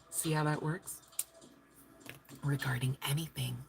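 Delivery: soft whispered afterthought; whispered aside. Real, not acted. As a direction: drop to a breathy-free whisper aside. Aside after teasing line.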